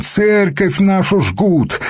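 high, drawn-out cry of alarm; wailing. A narrator's reading. Monk tells of his burning church, wailing. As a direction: wail the news high and slow. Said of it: "заголосил монашек".

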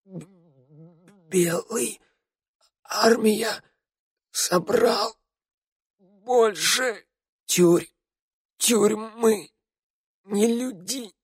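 high, halting dying gasps; broken wheeze. A narrator's reading. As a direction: force out each word while dying. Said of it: "прерывистый хрип Раду".

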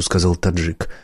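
ordinary delivery

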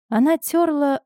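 ordinary delivery

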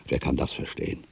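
a bare whisper to himself; whispered repeat, the voice all breath; whispered question. Acted. Repeats question, voice dropping away.

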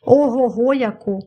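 mildly exclaimed, drawn out slowly; emphatic exclamation. A narrator's reading. Michael outdoes his friend's modest answer, emphatic exclamation. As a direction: stretch it out as a slow topper.

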